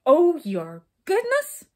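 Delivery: loud, slow, incredulous roar; roaring temper. A narrator's reading. King mocking the brownies' exclamation, roaring. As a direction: throw the words back furiously. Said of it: "suddenly roared the king in a temper".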